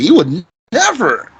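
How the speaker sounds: NEVER punched with a high pitch peak; emphatic negation. Shown as a demonstration. Teacher contrasts it with wouldn't, stress on NEVER. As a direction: make NEVER leap out, emphatically. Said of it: "To be emphatic in your negation".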